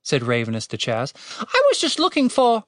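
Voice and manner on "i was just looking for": high-pitched bird voice; courteous little bird, pitched way up; deferential bird. A narrator's reading. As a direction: begin explaining as a polite high-voiced bird. Bird explains itself, cut off.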